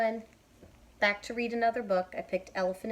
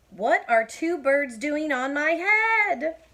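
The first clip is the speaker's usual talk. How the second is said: higher, louder, slow distressed cry; upset; 9 semitones higher, 11 dB louder, slower than usual. Acted. Elephant complaining to Piggy, loud slow wail. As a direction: wail it out in dismay.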